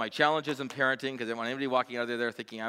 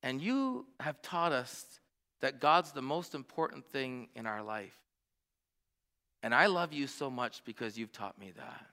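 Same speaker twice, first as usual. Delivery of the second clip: daughter's sincere words; 4 semitones higher, 4 dB quieter, slower than usual. Retold or imitated. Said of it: "She says".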